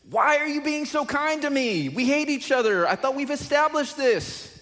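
high, indignant mock voice of someone who hates you; annoyed enemy. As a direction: play the baffled rival asking why you're kind. Enemy reacting to kindness.

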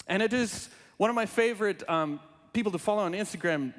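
ordinary talk

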